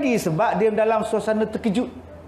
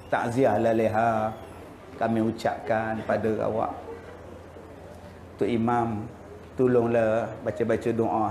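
much lower, softer village elders offering condolences; a hint of solemn old men; lightly voiced mosque elders; 9 semitones lower, 4 dB quieter than usual. Mosque chairman and imam voiced. (suara orang tua surau yang rendah dan lembut)

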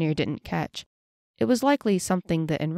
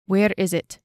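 mildly forceful, louder demand; 5 dB louder than usual. A narrator's reading. Elf interrogates his pinned captive, demanding. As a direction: press hard for an answer. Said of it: "he demanded".

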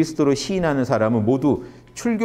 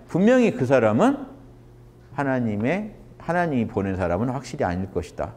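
ordinary delivery

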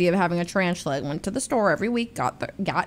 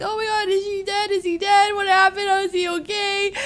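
worried fuss; 10 semitones higher than usual. Retold or imitated. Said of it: "And I'm like".

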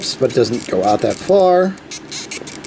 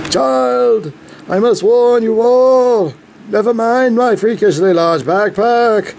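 pompous alien warning; 8 semitones higher, slower than usual. Pretend. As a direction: grandly warn the child of doom. Repeating the Tobias voice bit.

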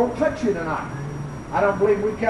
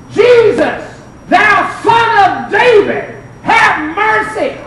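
loud, high, slow plea; drawn-out cry for mercy; acted crying out; 8 semitones higher, 5 dB louder, much slower than usual. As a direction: call out to Jesus in full preaching voice. Preacher voices blind Bartimaeus crying out.

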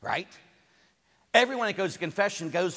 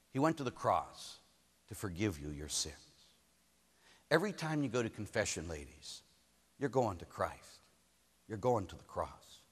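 low, soft reassurance; solemn, tender preaching; 7 semitones lower, 6 dB quieter than usual. Real, not acted. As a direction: gently hush the congregation with mercy.

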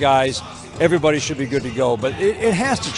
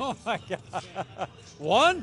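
slow, soft disbelief breaking into a laugh; a stunned laugh at the question; overwhelmed surprise; 4 semitones higher, 10 dB quieter, much slower than usual. Real, not acted. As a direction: caught off guard by an impossible choice. Asked to pick one moment.

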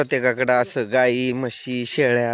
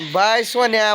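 ordinary talk

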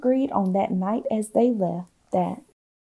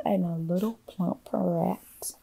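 ordinary delivery

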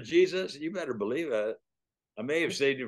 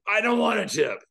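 angry cabbie yell, louder and higher; retold yell; 7 semitones higher, 5 dB louder than usual. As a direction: bark it back like an offended driver. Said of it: "The guy yells at me".

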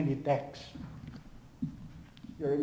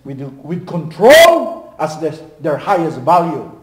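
preaching at full force; 11 dB louder than usual. Acted. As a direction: thunder it from the pulpit.

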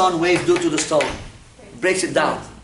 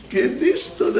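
gleeful punchline; 8 semitones higher, slower than usual. Real, not acted. Punchline about wife's kidney stone.

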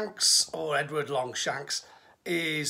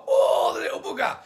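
higher, louder outraged cry of a shot king; mock-outraged king yelping; wounded king; 8 semitones higher, 4 dB louder, faster than usual. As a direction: play Richard indignant at being shot. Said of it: "Richard, okay, is like".

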